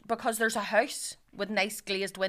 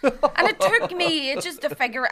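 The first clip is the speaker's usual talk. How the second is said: ordinary talk, nothing marked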